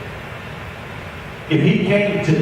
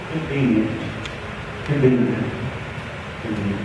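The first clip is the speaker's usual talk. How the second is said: fading repetition; 9 semitones lower, 8 dB quieter than usual. Real, not acted. Preacher echoing the excuse of convenience, fading into quiet. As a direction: lower and softer on each convenient. Repeated word sinking away.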